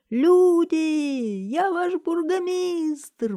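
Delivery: very high, loud, drawn-out wail; wailing cry. A narrator's reading. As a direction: wail it out to the crowd. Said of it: "возопил он". (пронзительный протяжный вопль)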